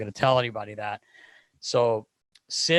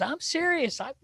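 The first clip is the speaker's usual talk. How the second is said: very high-pitched mock threat; squeaky impression of an indignant buddy; Phil Kessel. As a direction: insist in a comically high voice. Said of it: "And he goes".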